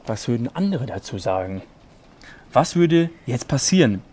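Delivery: low, hushed storyteller reading; serious bedtime-story narration. Acted. Reads fanfiction aloud.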